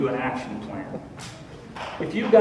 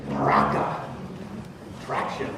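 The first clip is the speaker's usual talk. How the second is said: "Baraka," much lower and gritty, with traction; low, grinding "Baraka" to show its grip; heavy, gravelly-edged "Baraka"; with edge; 14 semitones lower, 5 dB quieter than usual. Acted. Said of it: "edgy word contrast".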